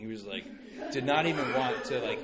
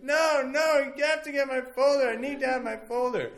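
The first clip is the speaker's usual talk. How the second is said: high, loud mock tantrum; hammed-up child's desperate protest; crying toddler imitation; 10 semitones higher, 6 dB louder than usual. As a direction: wail it like a distraught three-year-old. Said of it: "started crying".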